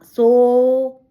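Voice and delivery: सो held long on a steady O; teacher sustaining सो to show its length; long vowel demo. Shown as a demonstration. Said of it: "तीन मात्रा है".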